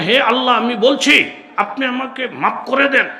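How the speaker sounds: mock prayer in careful standard Bangla, pitched high; formal standard Bangla. Shown as a demonstration. Preacher mocking over-correct munajat, formal standard Bangla. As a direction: parody a stiff standard-Bangla supplication, loud and high. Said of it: "এরকম শুদ্ধ শুদ্ধ কথা কইলে".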